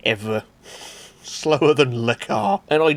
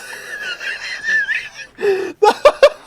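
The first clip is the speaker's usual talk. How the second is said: very high, shouted real laughter; shrieking laugh; 3 dB louder than usual. Real, not acted. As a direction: squeal with laughter.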